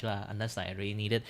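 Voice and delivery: usual speaking voice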